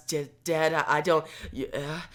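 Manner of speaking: halting, softly voiced shock; stunned stammer. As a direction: falter as if lightly stunned, trailing into silence. Old woman told of death.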